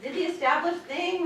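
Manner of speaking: ordinary talk, nothing marked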